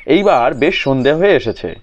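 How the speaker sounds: ordinary delivery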